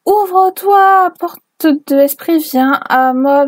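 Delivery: high, loud, slow spell chant; mock summoning incantation. Reader performing the fanfic's spirit summoning, slow and loud. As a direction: summon the spirit gate with over-the-top drama.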